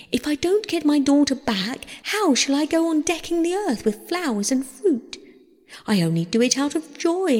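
high, indignant mother's appeal; impassioned protest. A narrator's reading. Demeter threatens Zeus to let earth wither, impassioned protest.

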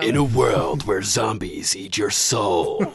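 slow, ominous trailer narrator; horror trailer voice. Pretend. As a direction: intone it like a zombie movie trailer. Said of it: "movie trailer voiceover".

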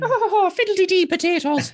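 high, hammed-up stage Irish exclamation; mock stage Irish. Joking about clichéd Irish portrayals, high hammed-up stage Irish. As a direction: camp it up like a cartoon Irish lass. Mocking stereotyped Irish talk.

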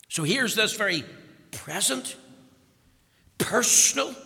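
building preaching. Acted. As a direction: climb word by word to "personal".

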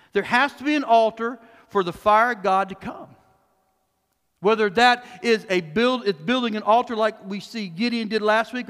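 normal talking voice

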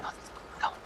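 barely audible whisper; whispered command. Acted. Player tells companions to hide from strangers, whispering. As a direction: whisper the order so nobody outside hears.

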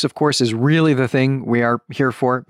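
ordinary talk, nothing marked

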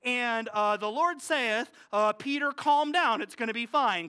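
hammed-up King James reading; mock Gospel narration. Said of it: "I wish Mark would be like".

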